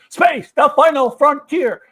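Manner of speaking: loud, slow, hammed-up captain's monologue; shouted Shatner impression. Mocking Pike's intro credits, slow loud hammed-up captain voice. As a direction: bellow the intro like a bad captain parody. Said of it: "doing a bad William Shatner impression, but he was shouting it".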